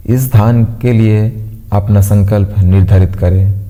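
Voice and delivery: calm, evenly paced meditation voice with long pauses; guided meditation. Acted. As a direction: guide them gently into stillness, pausing between instructions. (शांत, ठहराव भरी ध्यान कराने वाली आवाज़)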